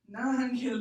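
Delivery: high pleading cry; begged. A narrator's reading. Rigo begging the bully to leave his shoes, loud pleading. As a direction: plead with him not to take them. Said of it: "Rigo begged".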